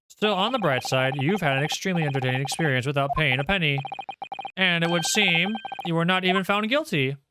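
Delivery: normal talking voice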